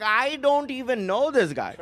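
high, mock-innocent denial; hammed-up cowardly disowning; scared dad. Dad disowns son before mom, mock scared. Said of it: "he's scared of my mom".

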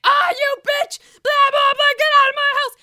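high, very loud hammed-up shriek; over-the-top imitation of a raging outburst; mock furious screaming. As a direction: scream like a knife-waving parody villain. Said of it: "comes back out with a fake knife".

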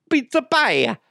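badly faked Italian accent. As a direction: sing out a clumsy Italian cliché. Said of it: "I suck at Italian".

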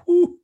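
high, loud exhale of delight; delighted whew. Real, not acted. Man relishing his apple pie with ice cream, loud delighted whew. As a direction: blow out a loud whew of pleasure. After describing dessert.

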